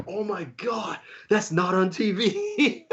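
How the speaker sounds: high, stretched starstruck disbelief; freaking out. Retold or imitated. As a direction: start hushed and high, then stretch it out into a laugh. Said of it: "I was still freaking out".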